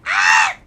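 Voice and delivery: sudden high, very loud scream; mock violent scream. Imitating a gory animation to a friend, loud scream. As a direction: let out a short, full-blast screech. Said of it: "really violent, really gory".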